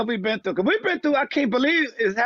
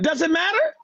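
put-on brushing off kids; 8 semitones higher than usual. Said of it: "mock reply to kids".

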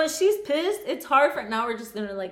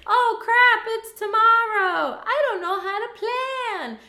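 mocking ditzy friend; 9 semitones higher than usual. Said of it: "she's like".

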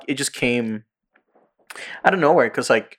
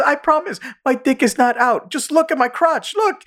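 very high, squeaky pleading denial; mock protesting. Retelling the accused man swearing innocence, mock protesting. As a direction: insist in a comic, high-pitched squeal. Acted back-and-forth quarrel from show.

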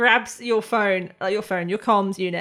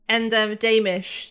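ordinary talk, nothing marked

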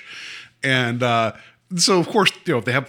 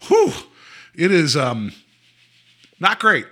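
usual speaking voice

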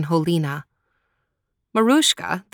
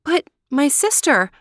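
high, louder anxious plea; pleading cry; 8 semitones higher, 3 dB louder than usual. Acted. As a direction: beg in disbelief. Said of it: "cried poor Marushka".

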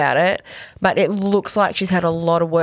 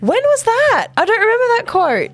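surprised; 3 dB louder than usual. Real, not acted. Surprised she missed it.

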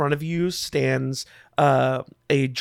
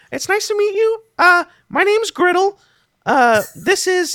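put-on much higher-pitched young goblin voice; goblin greeting; 5 dB louder than usual. Goblin introduces himself to the party, high and polite. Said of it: "goblin ... nods and greets you all, and he says".

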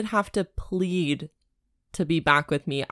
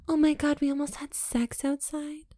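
mocking heroine; 7 semitones higher, 5 dB quieter than usual. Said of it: "mocking the heroine".

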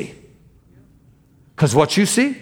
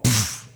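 imitated impact noise; 5 semitones lower, 5 dB quieter than usual. Imitates her hitting a wall.

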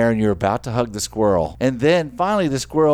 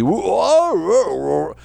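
Wookiee noise; 7 semitones higher, much slower than usual. Retold or imitated. Hugging the bear cub, a Wookiee moan. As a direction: groan like a Wookiee begging. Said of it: "you sound like a Wookiee".

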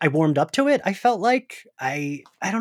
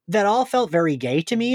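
ordinary delivery

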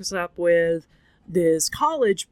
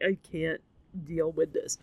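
softer, lowered voice, giving up; defeated; 3 semitones lower, 7 dB quieter than usual. Retold or imitated. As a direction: say it quietly, worn out by crying. Retold overwhelmed reaction in bed.

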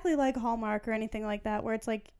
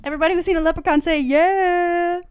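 loud, high crowd-rousing call; loud mock rallying cry; imitated viral-video shout; 6 semitones higher, 7 dB louder than usual. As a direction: holler it quickly, stretching the final yeah. Quoting a viral leprechaun video.

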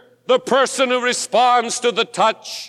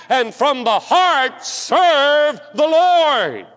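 slow, high, swelling preacher's cry; fervent climax; 6 semitones higher, slower than usual. Acted. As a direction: lift it up and stretch every word.

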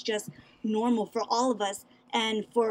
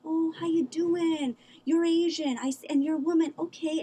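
condescending gentle voice; 7 semitones higher than usual. Retold or imitated. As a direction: coo at her like she is fragile. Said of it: "It's like".

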